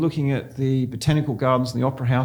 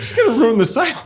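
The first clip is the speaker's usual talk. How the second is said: much higher and louder, laughing through it; 10 semitones higher, 5 dB louder than usual. Retold or imitated. Telling the host about the daughter on the windy balcony, laughing hard. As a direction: squeal it out between laughs.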